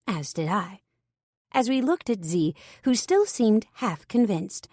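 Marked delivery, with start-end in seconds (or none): none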